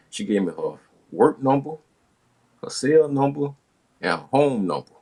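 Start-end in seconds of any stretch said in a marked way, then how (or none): none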